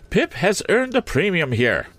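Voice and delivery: cold lady of the house looking them over; Miss Havisham. A narrator's reading. Miss Havisham addressing Joe, haughty.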